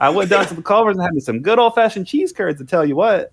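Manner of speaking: lightly put-on Wisconsin accent; bragging about cheese curds in a mock Midwestern accent. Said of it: "accent here".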